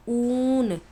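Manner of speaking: ऊन with a long, drawn-out 'oo'; लंबा खिंचा ऊ. Shown as a demonstration. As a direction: hold the opening vowel of ऊन. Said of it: "खूब खींच कर पढ़ा जाता है". (ऊन का ऊ खूब खींचकर)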